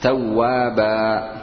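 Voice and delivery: توابا very slowly, ending in a long aa with no n; tanween turned to alif. Shown as a demonstration. Teacher demonstrating a pausal form, tanween turned to alif. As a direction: stretch توابا into a long final aa. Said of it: "بقلب التنوين ألفا".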